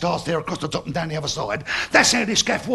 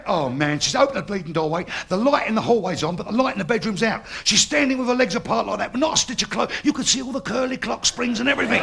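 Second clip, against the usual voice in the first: usual speaking voice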